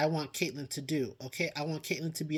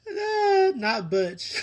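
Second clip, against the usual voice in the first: shocked cry; 7 semitones higher, 5 dB louder, much slower than usual. Real, not acted. Reacting to the dog.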